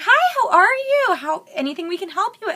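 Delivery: high, bright mock sales chirp; bubbly salesgirl. Imitating the Sephora girl greeting a customer, high and bubbly. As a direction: chirp it like an overeager shop girl. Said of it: "super upbeat and bubbly".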